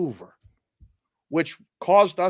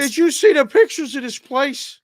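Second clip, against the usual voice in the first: excited; 13 semitones higher, 6 dB louder than usual. Real, not acted. Gushing about a gorgeous town.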